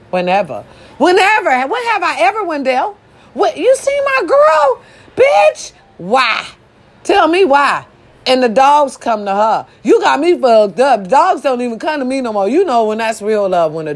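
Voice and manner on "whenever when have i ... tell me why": high, taunting comeback; sassy retort. Pretend. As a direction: throw it back at Wendy. Mock Brat retort to Wendy.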